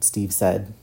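ordinary delivery, nothing marked

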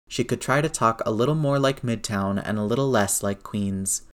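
plain reading voice

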